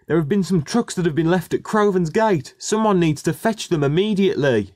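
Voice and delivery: high-pitched urgent announcement; urgent shout. A narrator's reading. Orville tells the depot about stranded trucks, urgent shout. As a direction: raise the alarm in a high voice. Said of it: "he shouted".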